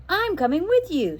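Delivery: put-on a firm girl, no arguing. A narrator's reading. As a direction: insist you're coming along. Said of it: "she said firmly".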